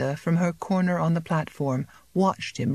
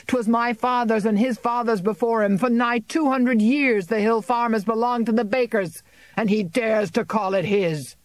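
angry tirade; 6 semitones higher than usual. A narrator's reading. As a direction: rage at the landlord before the crowd. Farmer rails at landlord.

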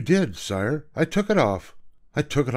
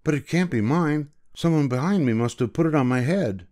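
put-on high, flustered boy's protest; stammering; 6 semitones higher than usual. A narrator's reading. Boy excuses the strange hat to the king, flustered. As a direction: plead innocence before the king. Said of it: "he stammered".

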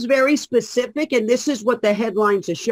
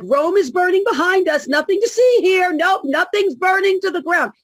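mocking the media; 7 semitones higher than usual. Guest mocks media ignoring the crisis, breezy mock denial. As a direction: play the oblivious newsman waving it off. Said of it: "going like".

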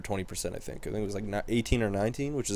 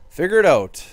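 higher and louder, a sharp exasperated shout; frustrated yell; 8 semitones higher, 10 dB louder than usual. Real, not acted. Gamer yelling at his slumping hockey team, loud and exasperated. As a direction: bark it at a team that keeps losing.

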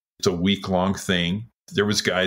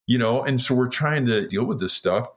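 ordinary talk, nothing marked

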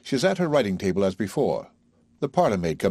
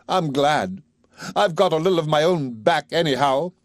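put-on higher, defiant girl's retort; spiteful Eliza; 8 semitones higher, 4 dB louder than usual. A narrator's reading. Eliza answering Higgins back, defiant and pleased. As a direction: savor getting a little revenge.

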